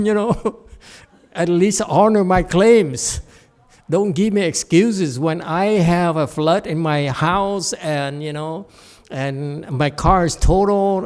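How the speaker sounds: getting emotional. Real, not acted. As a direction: plead against unfair denied claims. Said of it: "I'm getting emotional".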